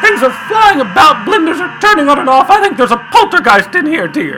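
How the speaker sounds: put-on an agitated old woman insisting on a ghost; alarmed old lady. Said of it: "Missus Potter Butter Butterton was just telling".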